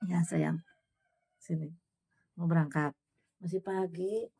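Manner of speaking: soft, gentle call to her little son; tender coaxing. Real, not acted. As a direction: coax him kindly to come. Mother softly calls her child.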